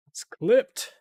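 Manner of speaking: high, loud little victory call; triumphant exclaim. Real, not acted. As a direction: announce the clip with glee.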